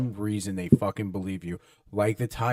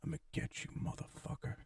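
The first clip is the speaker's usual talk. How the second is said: much softer, higher creepy threat down the phone; menacing hushed threat; 6 semitones higher, 17 dB quieter than usual. Retold or imitated. Prankster on the phone to the smoking kid, hushed sinister threat.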